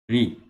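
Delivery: "ri" said short and clear with an i vowel; syllable with kasra. Shown as a demonstration. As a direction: model "ri" with a bright i. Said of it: "With the kasra".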